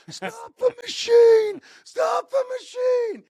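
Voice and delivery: high, drawn-out shout over machine noise; yelling at her. Retold or imitated. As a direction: yell to someone who can't hear you. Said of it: "He's yelling at her".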